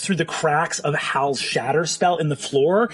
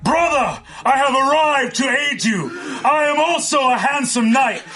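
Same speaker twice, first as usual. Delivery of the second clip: high, booming mock-heroic proclamation; heroic knight; 10 semitones higher than usual. Game master as illusory knight arriving to aid Azune, pompously heroic. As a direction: proclaim it grandly like a storybook knight.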